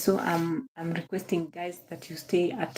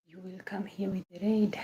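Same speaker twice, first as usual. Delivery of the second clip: soft whispered repeat of the request; 7 dB quieter than usual. Acted. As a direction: drop to a breathy whisper for emphasis.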